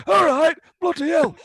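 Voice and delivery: high, loud jokey Yorkshire voice; hammed-up Halifax accent; mock Halifax accent. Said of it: "I'm sure he doesn't talk like that".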